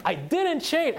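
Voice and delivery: high, loud retold outburst; getting angry. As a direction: shout the denial as he did. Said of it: "And he's getting angry".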